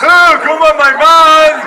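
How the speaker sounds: mock admiring wife. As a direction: gush loudly like a proud wife. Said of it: "Deine Frau kommt überall mit".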